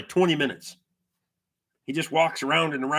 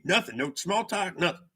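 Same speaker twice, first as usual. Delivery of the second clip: ordinary talk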